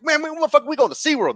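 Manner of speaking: put-on high, loud rowdy kid voice; hyped-up kids yelling out. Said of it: "they were like".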